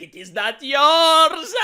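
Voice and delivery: slow, loud gloating refusal; mock smug villain. Gloating at the crying fan, slow loud mock-villain refusal. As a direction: lord it over them slowly. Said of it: "And I will be like".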